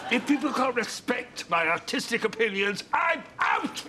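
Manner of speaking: huffy exit. Real, not acted. Actor quits in a huff.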